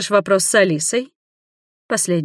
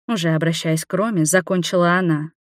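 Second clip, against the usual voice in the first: usual speaking voice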